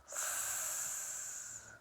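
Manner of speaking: quiet, unvoiced hiss; just the S hiss; hissed S sound. Shown as a demonstration. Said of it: "make sure to close the gate".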